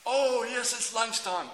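high, loud whoop of joy; mock elation. Worker seeing lunchtime come at last, mock elation. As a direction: burst out in hammed-up delight.

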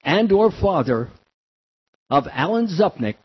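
slow, loud public-address read; loudspeaker announcement. Retold or imitated. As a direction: announce it over beach loudspeakers, slowly. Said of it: "they made this announcement".

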